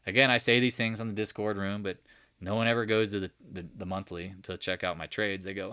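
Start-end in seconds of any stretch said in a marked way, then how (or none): none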